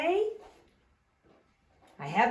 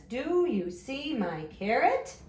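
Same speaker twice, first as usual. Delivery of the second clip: higher, slowish question lifting on CARROT; question rise on CARROT; 5 semitones higher than usual. Shown as a demonstration. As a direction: ask it with the voice up at CARROT. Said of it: "your voice goes up at the end".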